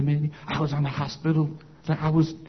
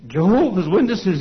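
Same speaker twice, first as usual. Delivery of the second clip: attempted old-time Southern preacher accent, higher and louder; 5 semitones higher, 5 dB louder than usual. Preacher reacting to Jehovah's Witnesses, attempted Southern accent. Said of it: "I can't imitate his accent".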